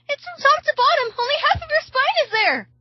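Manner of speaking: very high-pitched, panicked screaming; screamed. A narrator's reading. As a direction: keep screaming, high and frantic. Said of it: "she screams".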